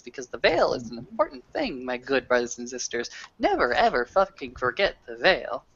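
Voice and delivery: usual speaking voice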